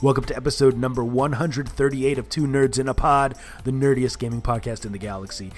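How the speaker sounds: loud, big announcer welcome; booming kids show opener. Acted. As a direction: boom it out like a show host.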